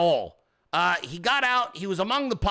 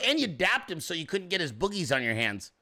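ordinary talk

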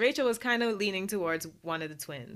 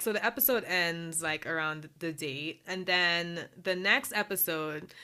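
usual speaking voice

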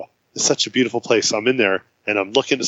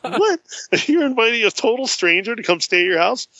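much higher, squeaky astonished what; 11 semitones higher than usual. Retold or imitated. To the kind Iowa lady, a much higher astonished retold question. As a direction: pitch leaps up in disbelief. Stranger offers her house.

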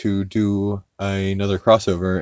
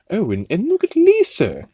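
high-pitched imitation of a cartoon accent; excited high mock accent; Stewie; 12 semitones higher than usual. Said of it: "he's doing his Stewie accent".

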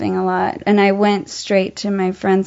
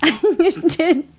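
higher, breaking up with real laughter; laughing; 8 semitones higher, much slower than usual. Real, not acted. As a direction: barely get the confession out for laughing. Cracking up at own mistake.

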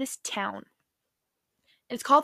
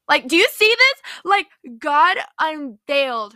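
much higher and louder, thrilled; excited; 4 semitones higher, 9 dB louder than usual. Real, not acted. Preacher thrilled by the story, excited high call. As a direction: ask the room as if you can't contain it.

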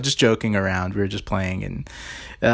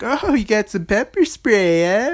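slow, higher teasing voice; mocking kid; 9 semitones higher, slower than usual. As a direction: tease him like a smart-aleck kid. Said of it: "I'm like".

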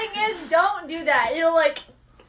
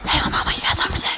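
mock seductive whisper; much faster than usual. Stopping to coax an answer down there, mock whisper.

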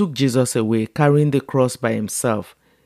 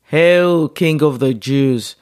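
soldiers jeering at Jesus, a mocking hail; 3 semitones higher, 4 dB louder, slower than usual. A narrator's reading. Soldiers mocking the prisoner, mock royal greeting. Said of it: "they mocked".

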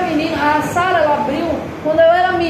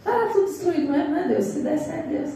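mock resigned sigh; 4 semitones higher, 6 dB quieter than usual. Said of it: "pessoas falando assim".

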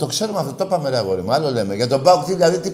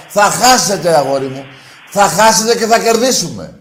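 loud gloating; 3 semitones higher, 6 dB louder than usual. Real, not acted. Host taunting PAOK caller.

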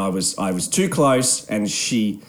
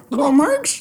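high, barked-out mock dog voice. Co-host butting in about dog show, dog voice. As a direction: yelp the name as a dog would. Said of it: "like a dog".

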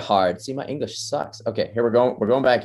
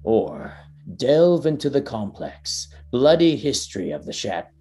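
grand, measured trailer voice, slowing into the last words; epic trailer narration. Acted. Podcaster reading the Elden Ring trailer aloud, epic narration. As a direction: narrate it like a fantasy game trailer.

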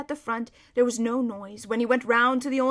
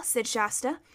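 ordinary delivery

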